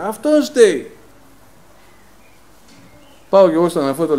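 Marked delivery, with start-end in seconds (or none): 0.0-0.9 high, loud retold yell; the coworker shouting again from across the shed; imitating a shout. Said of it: "πάλι φώναζε".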